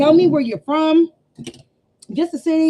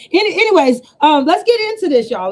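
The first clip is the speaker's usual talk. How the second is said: louder push; 4 semitones higher, 7 dB louder than usual. Real, not acted. Restarting the live after a tangent.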